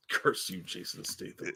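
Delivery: 半开玩笑地低声诅咒; a joking whispered curse; whispered mock curse. Real, not acted. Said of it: "mock curse under breath".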